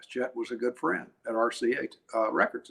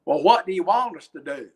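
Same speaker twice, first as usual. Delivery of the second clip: higher-pitched put-on East Tennessee twang; fake East Tennessee accent; 5 semitones higher, 6 dB louder than usual. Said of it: "said in this fake East Tennessee accent".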